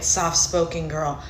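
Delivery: usual speaking voice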